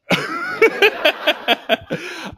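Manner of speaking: high nervous giggle. Real, not acted. Laughs after losing track.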